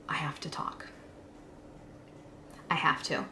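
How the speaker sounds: voiced thought, nearly whispered; hushed inner resolve. Teen telling herself she must speak, hushed. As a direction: say it softly, as if only to yourself.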